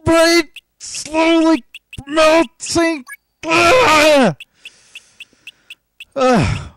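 high, loud, slow groaning; dismayed wail. Real, not acted. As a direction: whine it out in despair. Dismay after bad result.

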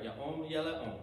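soft hebrew echo. Shown as a demonstration. Teacher echoing the Hebrew softly beside the English. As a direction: let Ya'om le ya'om fall away softly. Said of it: "Almost sounds like it does in English".

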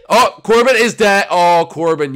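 loud, high announcement easing down; excited outcry. Real, not acted. Gamer seeing Corbin die, loud excited outcry. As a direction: shout the death as it happens. Corbin has just died.

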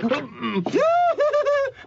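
shrill mocking laugh; loud high laugh. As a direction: burst out laughing. Laughs at awful singing.

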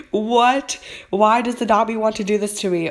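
high, quick outraged protest; indignant disbelief. Real, not acted. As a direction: blurt out disbelief at the unfair result. Protests getting the wrong pet.

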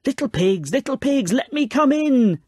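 lightly voiced wolf demanding entry; growly wolf. A narrator's reading. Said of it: "said a growly voice".

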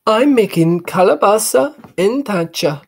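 high, proud mother; mother announcing his favorite dish; proud mom. Acted. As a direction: beam with pride. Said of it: "Mom said proudly".

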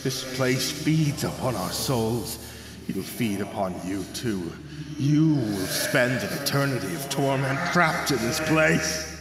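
slow hollow voice of a rotting dead man; doom him slowly and heavily; slow hollow ghost lament; groaning corpse. Acted. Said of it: "Lucas groaned".

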